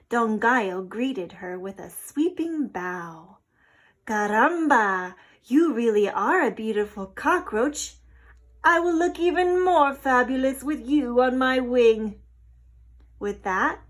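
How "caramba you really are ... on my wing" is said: loud, puffed-up rooster voice; boastful rooster showing off to the cockroach; vain rooster. A narrator's reading. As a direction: strut it out like a proud rooster. Rooster character voice.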